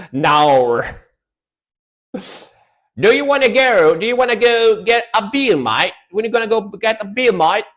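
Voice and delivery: high, hammed-up Aussie bloke asking for a beer; a pub mate in a cartoonish Australian accent, pitched well up. Inviting a mate for a beer, hammed-up Australian accent. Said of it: "This sounds like what Australian people say".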